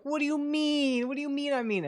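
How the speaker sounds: high, innocent whine of confusion; mock-innocent friend playing clueless; Soma impression. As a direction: play dumb in a high squeaky voice. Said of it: "that's my Soma impression".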